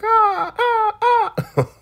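very high, loud mock screeches; hawk-like pleasure screech. Imitating the elder mother being made happy, hawk-like screeching. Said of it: "like a hawk or something".

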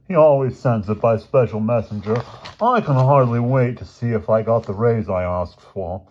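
put-on loud, affected rich-boy voice; affected George, pleased. Rich boy expecting money from his father, affected and pleased. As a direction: put on a self-important upper-crust boy.

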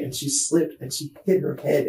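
usual speaking voice

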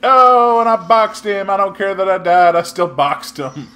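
high, loud proud exclamation; gleeful boast. Real, not acted. Gamer bragging he boxed an enemy before dying, loud and gleeful. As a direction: relish the win.

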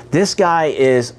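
ordinary talk

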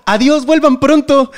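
high, slow send-off; cemetery worker's farewell. Acted. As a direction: draw out a high-pitched goodbye to departing visitors.

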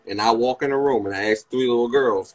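ordinary delivery

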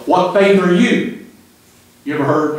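normal talking voice

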